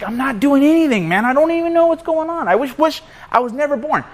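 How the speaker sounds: high-pitched acted protest; hammed-up, helpless exasperation; exasperated protest. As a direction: plead innocence, voice pitched well up. Job protesting to friends in story.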